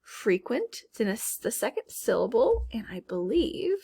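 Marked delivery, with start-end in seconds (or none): none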